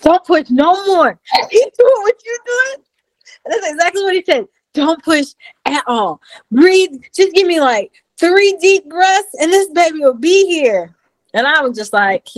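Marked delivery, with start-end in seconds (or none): 0.0-10.9 a doctor coaching her through the last breaths; doctor's calm orders. Retold or imitated. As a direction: voice the doctor gently, pitched up. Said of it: "he's like".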